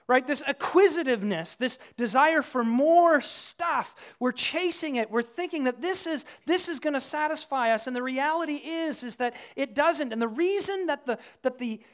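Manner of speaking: ordinary talk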